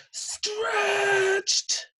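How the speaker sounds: mock stretching yawn. Farmer's big morning stretch.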